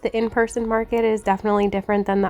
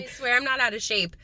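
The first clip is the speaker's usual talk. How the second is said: ordinary talk, nothing marked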